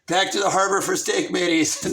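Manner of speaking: loud, low command to the crew; booming buccaneer voice; pirate captain. Acted. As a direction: bellow orders to your shipmates. Pirate captain orders crew home.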